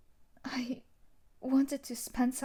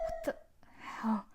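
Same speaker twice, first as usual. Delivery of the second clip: startled; 5 dB quieter than usual. Acted. Shocked by police arrival.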